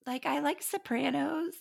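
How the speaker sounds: put-on naive teen girl voice. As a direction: play the clueless sweet fifteen-year-old. Her younger self answering, naive.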